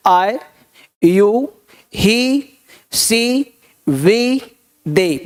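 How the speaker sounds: each pronoun alone with a short pause after it; slow pronoun drill. Shown as a demonstration. Teacher showing students how to say the pronouns, spaced drill. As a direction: drill the pronouns one by one, clearly. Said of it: "यानी I ऐसे बोलना है".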